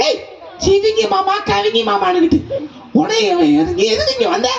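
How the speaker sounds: mocking high giraffe, scoffing at the goat. Giraffe mocks the goat's greeting, high voice.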